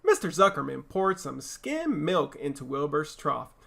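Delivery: ordinary delivery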